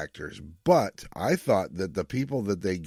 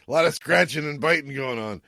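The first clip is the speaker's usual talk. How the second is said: higher, louder McCoy impression; lightly voiced grumpy ship's doctor; quoting McCoy; 7 semitones higher, 4 dB louder than usual. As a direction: put on a gruff doctor's voice, raised. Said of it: "McCoy says something like".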